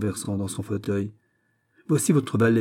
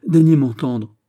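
louder, higher entreaty; pleading; 4 semitones higher, 8 dB louder than usual. A narrator's reading. Governor begs the bishop to hear him, pleading. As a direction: beg for a hearing. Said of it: "je vous en supplie".

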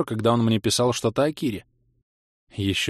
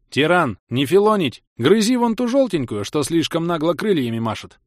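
loud, high-pitched orders; commanding; 7 semitones higher, 4 dB louder than usual. A narrator's reading. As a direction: order a pet about briskly. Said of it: "и скомандовал". (громкая команда питомцу)

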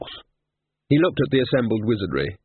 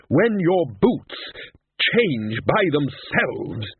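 slow, higher-pitched growl of warning; 6 semitones higher, much slower than usual. A narrator's reading. Ridcully warns wizards about boots changing, growled. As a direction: drag the words out in a mild growl. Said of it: "he growled".

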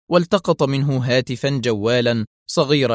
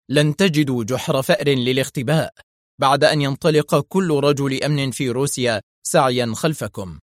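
ordinary delivery

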